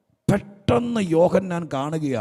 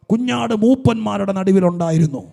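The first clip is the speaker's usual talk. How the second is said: loud, high, rising preaching shout; preaching at full force; 8 semitones higher, 13 dB louder than usual. Acted. Preacher shouting the promise to the weeping congregation. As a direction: thunder it out to the whole church.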